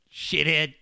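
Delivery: ordinary delivery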